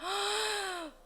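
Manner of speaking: drawn-out intake of breath; long mock gasp. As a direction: gasp long at the children's answer. Reacting to the children's answer. (길게 헉 하고 놀라기)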